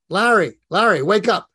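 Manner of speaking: loud, high call to wake someone. Retold or imitated. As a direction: shout his name to wake him up. Waking dead mentor Larry.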